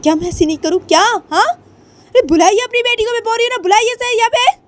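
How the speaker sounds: very high, loud screaming; angry mother screaming at the host; furious scream. Acted. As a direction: scream in a rage to bring her.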